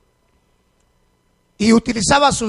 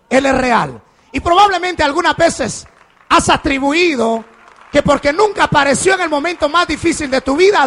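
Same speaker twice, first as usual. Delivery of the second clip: louder, higher, proclaiming with force; fiery preaching; 5 semitones higher, 4 dB louder than usual. Acted. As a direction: preach it to the whole church. (predicación fervorosa, en voz alta)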